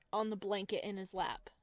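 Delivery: plain reading voice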